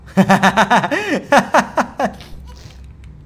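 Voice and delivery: surprised laugh. Real, not acted. Laughs at shrunk baby.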